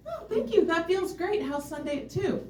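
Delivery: light, high, airy imitation of Moon; mock-sweet Moon voice; Moon's breezy reply.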